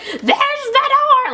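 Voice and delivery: high, drawn-out yell after someone; mock yelling. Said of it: "like, yelling after her".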